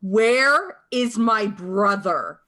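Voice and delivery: slow, high, loud screamed demand. Acted. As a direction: scream the question slowly, full force. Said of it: "just screams".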